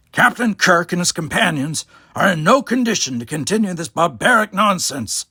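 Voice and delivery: the doctor calling up to the dictator in anger; angry. Acted. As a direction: call it up, furious at the cruelty. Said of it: "his face suffused with anger".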